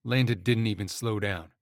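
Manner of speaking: ordinary delivery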